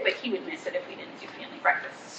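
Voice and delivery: hushed, knowing aside; confiding whisper about her son. Real, not acted. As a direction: whisper it like a secret about him.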